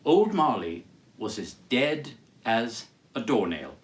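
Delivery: ordinary delivery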